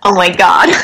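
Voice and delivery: light American accent with amused disbelief; laughing-edged Californian exclamation. Shown as a demonstration. Actor put on the spot by co-hosts, Californian accent. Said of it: "U S A California accent".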